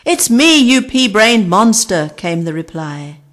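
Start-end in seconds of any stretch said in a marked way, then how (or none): 0.0-2.1 rude insult. Acted. Rude bird insults Darcy.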